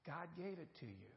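normal talking voice

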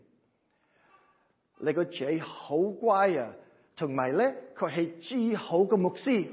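demonstrating a long Cantonese greeting. Shown as a demonstration. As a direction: teach the Cantonese greeting phrase by phrase. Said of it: "and then here's the greeting".

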